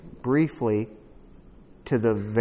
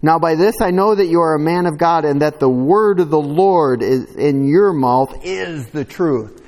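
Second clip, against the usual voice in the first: faster, louder reading of "Now by this I know"; brisk scripture reading; 3 semitones higher, 4 dB louder than usual. Acted. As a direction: read it through with conviction. Reads scripture aloud.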